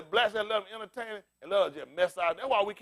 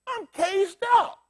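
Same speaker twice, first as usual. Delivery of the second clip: caged animal lament; 10 semitones higher, much slower than usual. Retold or imitated.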